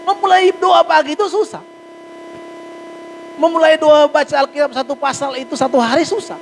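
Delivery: high, loud preaching push. Acted. Pastor urging the congregation to pray, high and loud. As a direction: preach it to the back row.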